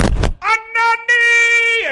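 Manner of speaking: very high, long drawn-out holler; yelled call. Acted. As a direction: holler it across the jungle. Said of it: "he yelled out".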